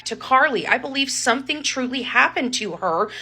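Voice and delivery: ordinary talk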